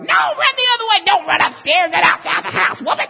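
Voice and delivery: high, frantic, fast yelling; mock screaming. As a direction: yell advice at a horror movie. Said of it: "you're screaming at the screen".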